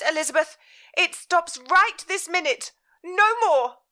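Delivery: high, loud, scolding mother; furious mother. Acted. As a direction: lay down the law, outraged. Mother's voice in story.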